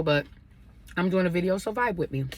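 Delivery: ordinary delivery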